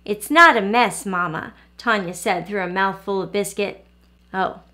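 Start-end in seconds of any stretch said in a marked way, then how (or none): none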